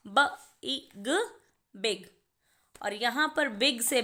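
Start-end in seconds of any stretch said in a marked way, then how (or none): 0.1-2.1 "ब, इ, ग, बिग" each letter sound separate, slowly. Shown as a demonstration. Teacher to students sounding each letter, slow. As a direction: spell out "ब, इ, ग" and then blend "बिग". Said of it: "हर एक letter की sound आ रही है".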